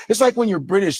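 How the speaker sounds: ordinary delivery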